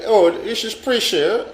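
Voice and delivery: mock caller. Imitating caller on phone.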